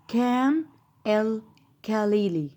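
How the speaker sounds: كان الخليلي slowly, word by word, with hard k. Shown as a demonstration. Teacher repeating the English reading, كان الخليلي slowly with k. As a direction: stretch كان الخليلي as "kan el kalili". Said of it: "برضو هقول عليه".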